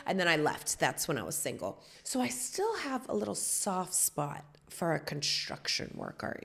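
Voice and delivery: usual speaking voice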